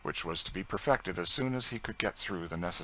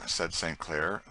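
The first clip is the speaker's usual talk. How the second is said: ordinary delivery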